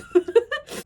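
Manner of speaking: high loud burst of laughing; burst of laughter. Real, not acted. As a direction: crack up completely.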